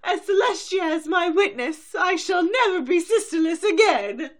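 high, loud grand vow; Scarlett O'Hara vow. Acted. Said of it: "I love Scarlett O'Hara".

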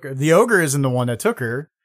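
normal talking voice

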